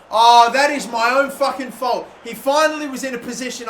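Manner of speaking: loud, high, furious at himself; real angry shout; angry self-blame. Real, not acted.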